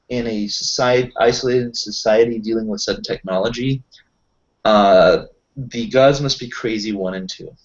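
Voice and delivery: usual speaking voice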